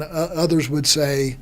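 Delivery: normal talking voice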